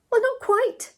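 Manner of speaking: very high, startled reply; startled haste. A narrator's reading. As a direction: answer high, caught still in bed. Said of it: "said Ethel, hastily jumping up".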